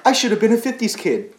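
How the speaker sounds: mock people who romanticise the past; whiny nostalgic. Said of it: "you know when people are like".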